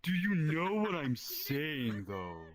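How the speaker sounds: slow, lightly put-on coworker drawl; a hint of the slow coworker; coworker impression. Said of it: "he talks like this".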